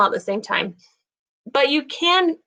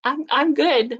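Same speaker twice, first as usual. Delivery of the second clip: higher, bright and easygoing, a light upbeat lift; cheerful contentment; 5 semitones higher than usual. Retold or imitated. Speaker imagining people sharing the good, cheerfully. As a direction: show how nice it is to say life is good. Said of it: "Why can't we just say".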